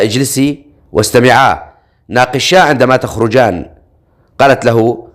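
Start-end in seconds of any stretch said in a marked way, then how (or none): none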